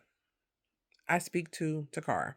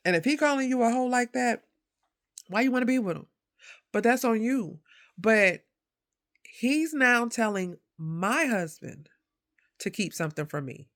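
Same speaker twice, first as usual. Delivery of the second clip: riled-up, higher-pitched retelling; retold indignation; 5 semitones higher, 3 dB louder than usual. Woman challenging her friend over the boyfriend and her husband, indignant. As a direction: press the point with mounting exasperation. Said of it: "I'm mad".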